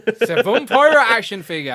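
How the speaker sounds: high, loud playful announcement; joking exclamation. Pretend. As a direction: pitch it up like a joke. Joking about a bum pirate.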